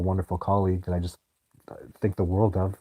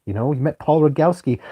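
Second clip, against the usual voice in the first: usual speaking voice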